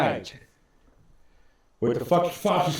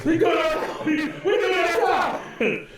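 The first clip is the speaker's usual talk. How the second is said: mock shout; 13 semitones higher than usual. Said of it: "I was like".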